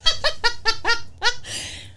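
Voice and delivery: high-pitched staccato laugh; helpless high giggling. Real, not acted. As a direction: crack up at the absurd picture. Laughing at the imagined scene.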